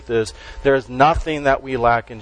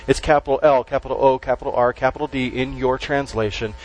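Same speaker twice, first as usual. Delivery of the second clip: ordinary talk, nothing marked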